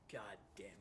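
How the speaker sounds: breathy whispered god damn it; whispered curse. Real, not acted. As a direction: curse under your breath. Frustrated after failed trick.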